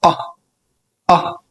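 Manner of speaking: "أه" clipped short like a speed bump; short o sound. Shown as a demonstration. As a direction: give a quick short "أه". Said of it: "فبنقول". (صوت أو قصير)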